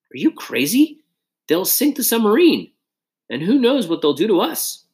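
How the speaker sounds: high-pitched, incredulous alarm; high, worried objection; alarmed protest. A narrator's reading. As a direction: protest in a panic, pitch jumping up. Alarmed protest at stopping the boat.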